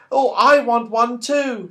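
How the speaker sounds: a doll asking eagerly for a present. Acted. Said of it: "said her doll".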